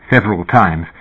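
plain reading voice

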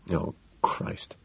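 exasperated aside, whispered. A narrator's reading. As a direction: breathe it out under your breath. Narrator's inner exasperated aside.

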